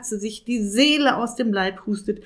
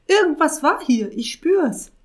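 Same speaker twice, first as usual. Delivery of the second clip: suspicious witch; 10 semitones higher, 6 dB louder than usual. Acted. Witch senses something.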